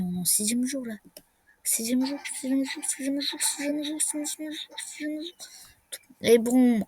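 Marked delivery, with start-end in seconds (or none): 1.6-5.3 higher-pitched mumble, fading softer with each repeat; muttered repetition. Pretend. Player fixates on the sixth day, fading high mutter. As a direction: repeat it over and over, fading away.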